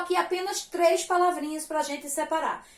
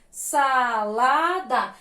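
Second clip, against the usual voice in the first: "Salada" very slow, in three separate syllables; 3 semitones lower, 3 dB louder, much slower than usual. Shown as a demonstration. Teacher joining spelt syllables for pupils, slow and separated. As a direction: stretch each syllable of "Salada".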